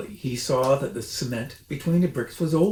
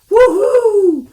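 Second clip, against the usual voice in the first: very high, loud whoop; wild whoop; 15 dB louder than usual. A narrator's reading. The smallest fox whoops after strong cider. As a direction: let out a tipsy yell.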